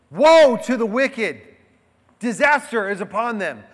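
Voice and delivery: preaching woes. Acted. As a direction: thunder out the woes like a prophet.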